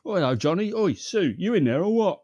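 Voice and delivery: hammed-up loud, high Cockney; Cockney accent. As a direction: holler it like a cartoon Cockney neighbour. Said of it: "in his fucking Cockney accent".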